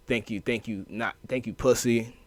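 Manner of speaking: ordinary delivery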